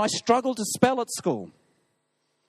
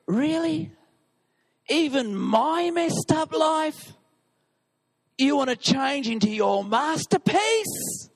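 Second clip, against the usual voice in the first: incredulous; 7 semitones higher, slower than usual. Retold or imitated. Mock incredulous doubt of God.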